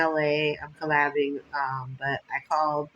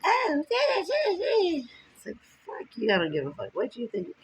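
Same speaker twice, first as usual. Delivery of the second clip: high drawn-out laughing mock; mocking haters; 11 semitones higher than usual. Mocking haters who will scoff at her, high and drawn out. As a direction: mimic haters with a sneer. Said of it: "my haters are gonna be like".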